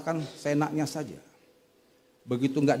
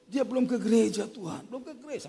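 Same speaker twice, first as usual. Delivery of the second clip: mock-tearful pleading, trailing softer; imitating tearful plea; 4 semitones higher, 6 dB quieter than usual. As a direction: plead as if retelling someone's weeping prayer. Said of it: "Sampai nangis itu". (menirukan ratapan sambil menangis)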